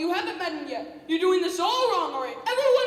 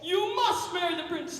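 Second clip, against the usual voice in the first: ordinary talk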